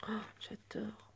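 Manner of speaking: tiny awed whisper, slow. Real, not acted. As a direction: barely breathe the words. Chuchote devant les dominos. (chuchotement ravi)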